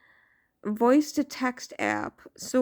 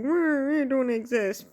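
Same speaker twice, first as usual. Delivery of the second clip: higher mock-whiny imitation; mocking naysayer; 3 semitones higher than usual. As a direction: mimic a silly doubter. Mocking people who deny the universe.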